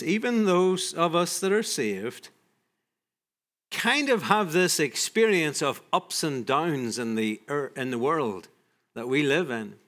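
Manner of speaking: usual speaking voice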